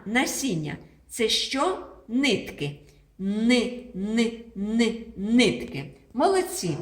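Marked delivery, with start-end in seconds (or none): 3.2-5.1 ни, ни, ни chanted clearly; teacher drilling the syllable ни. Shown as a demonstration. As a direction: chant ни, ни, ни for the class. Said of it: "Вимовляємо н".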